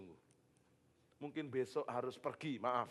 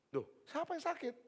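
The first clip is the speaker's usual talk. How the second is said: much higher, exasperated question; a fed-up pastor asking, voice jumping up. Retold or imitated. Pastor searching the house, fed up and higher. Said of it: "dia agak marah".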